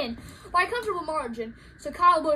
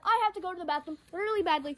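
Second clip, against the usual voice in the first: quick, softer confession; hurried; 5 dB quieter, faster than usual. Real, not acted. Commentator desperate for a bathroom break, hurried.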